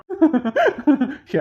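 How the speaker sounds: a quick amused laugh; brief chuckle; short laugh. Acted. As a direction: laugh off the teasing. Laughs at joke.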